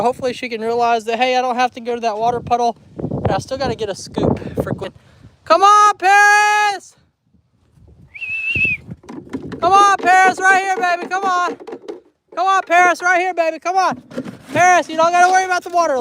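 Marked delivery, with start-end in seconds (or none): 5.4-16.0 high, coaxing call to an animal. Real, not acted. Calling Paris over to eat.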